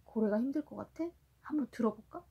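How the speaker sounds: plain reading voice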